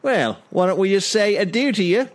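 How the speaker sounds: put-on Cockney customer settling up; Cockney accent. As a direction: wind up a deal in mock Cockney. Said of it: "it's, it's Cockney".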